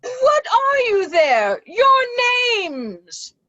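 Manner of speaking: high, shouted challenge; demanding shout to strangers; calling out. Acted. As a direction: call out high across a storm. Gloucester calls out challenge in storm.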